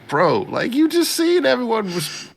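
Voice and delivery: high-pitched incredulous protest; exasperated retort, voice shooting up; mock exasperation. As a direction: protest in squeaky disbelief. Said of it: "And I'm like".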